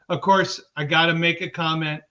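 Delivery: ordinary talk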